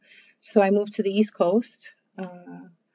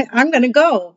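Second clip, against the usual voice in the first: excited; 5 semitones higher, 9 dB louder than usual. Retold or imitated.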